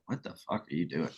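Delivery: quick, hushed mock disbelief; baffled onlookers. Onlookers puzzling at hash-making, quick hushed mock disbelief. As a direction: rattle it off low and fast, like puzzled onlookers. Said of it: "They were just like".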